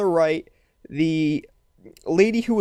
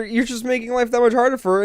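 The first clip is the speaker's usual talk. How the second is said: ordinary talk, nothing marked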